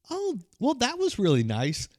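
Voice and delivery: very high, lilting opener; mock pleasantness. Retelling own bedroom remark after visit.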